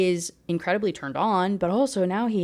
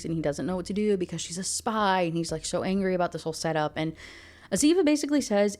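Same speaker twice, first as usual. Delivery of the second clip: usual speaking voice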